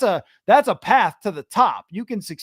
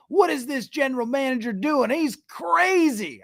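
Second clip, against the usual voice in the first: hammed-up Texas sports caller; Texas accent; 7 semitones higher than usual. Host mocking fans in a Texas accent. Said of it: "Texas accent when we do it".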